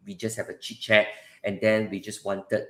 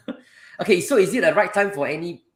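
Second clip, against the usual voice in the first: ordinary delivery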